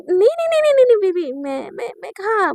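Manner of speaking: high, loud volley of no's easing into stammers; hammed-up nervous backpedalling; panicked denial. Naina denying she would ever scold Shivani, panicked denial. नैना डरकर सफ़ाई देती.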